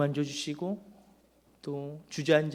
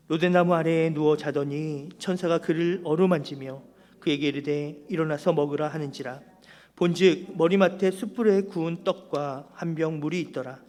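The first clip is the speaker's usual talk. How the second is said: loud, formal Bible reading to the congregation; scripture reading; 5 dB louder than usual. Acted. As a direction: proclaim the verses firmly, line by line. Reads the scripture passage aloud. (성경 본문을 힘 있게 봉독하듯)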